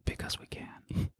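slow hushed whisper. Real, not acted. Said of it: "Why are we whispering?".

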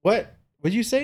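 retold incredulous parental query; imitating a puzzled parent. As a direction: ask the toddler to repeat it. Parent asks toddler to repeat, retold.

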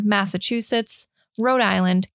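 ordinary talk

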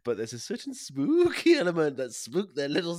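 high, loud, ghostly ham; mock spooky. Host overdoing spook puns until he apologises, mock spooky. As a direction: stretch the spooky words like a silly ghost.

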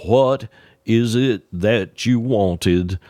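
put-on very slow, deliberate old Carolina drawl; Earl's slow talk. Earl asking Ned his order, slow drawling voice. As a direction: stretch every word like Earl. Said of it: "Earl spoke very slowly and deliberately".

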